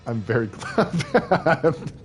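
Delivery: very slow, high, through laughter; laughing. Real, not acted. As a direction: say it while cracking up. Laughing through self joke.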